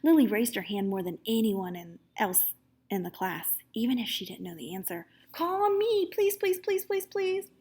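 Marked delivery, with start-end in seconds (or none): 5.3-7.5 eager begging. A narrator's reading. Lily begging to be called.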